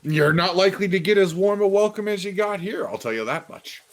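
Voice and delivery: high-pitched merchant voice, dropping at the end; wary shopkeeper. Acted. Game master plays shopkeeper.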